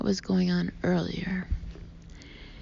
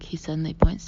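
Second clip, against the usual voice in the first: ordinary delivery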